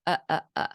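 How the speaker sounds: "uh, uh, uh" for umbrella, consonants dropped; three bare "uh" syllables; vowels only. Shown as a demonstration. Imitating a child saying umbrella as vowels only. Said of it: "and that's umbrella".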